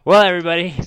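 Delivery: loud, high, mock-grand address to everybody; loud announcement. Real, not acted. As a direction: announce it like a host. Announcing to viewers mid-glitch.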